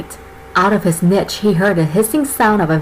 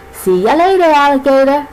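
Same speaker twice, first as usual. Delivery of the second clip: sing-song goodbye, pitched up; snake character voice; 4 semitones higher than usual. Acted. Cammy's cheerful goodbye.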